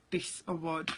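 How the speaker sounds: normal talking voice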